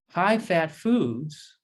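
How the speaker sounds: very slow, stretched High-fat foods; drawn out. Shown as a demonstration. Doctor warning patients, drawn-out emphasis. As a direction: stretch every syllable to drive it home. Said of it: "slow emphatic repetition".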